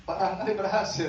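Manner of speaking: sarcastic repeat of the doctor's words; mocking echo. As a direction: mimic him scornfully. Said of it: "Kata-katanya itu loh, enak aja".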